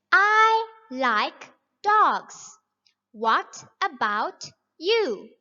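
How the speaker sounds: slow, clear, every word spelled out; slow model reading. Shown as a demonstration. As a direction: read it slowly for young learners. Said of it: "try this example on your own".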